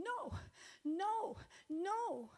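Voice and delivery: high-pitched, pleading string of no's; retold frightened refusal. Preacher reliving Satan's attack in her house, frightened refusal.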